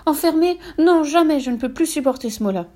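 high, loud panicked protest; distressed outburst, easing slightly at the end; crying out. A narrator's reading. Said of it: "Brumeline poussa un cri".

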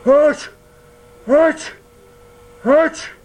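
three sharp high loud sneezes; comic sneeze fit. Acted. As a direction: burst out sneezing. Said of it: "Mangefeu sneezes".

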